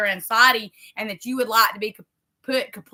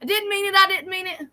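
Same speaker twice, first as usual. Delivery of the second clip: higher, pleading "I didn't mean it"; retelling her prayer to God, pitched up and pleading; 8 semitones higher than usual. Retold or imitated. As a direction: plead like taking back a complaint. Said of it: "it was like".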